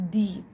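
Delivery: "the" said as "thee"; long "thee" for "the". Shown as a demonstration. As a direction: give "the" a long ee vowel. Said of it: "We will say it".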